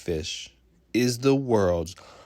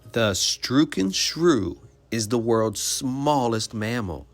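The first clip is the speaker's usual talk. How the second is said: normal talking voice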